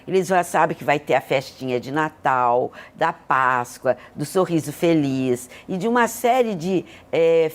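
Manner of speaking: usual speaking voice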